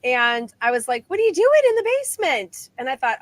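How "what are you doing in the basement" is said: high, quick call down the basement stairs; puzzled call to someone below, quick and high; retold calling down.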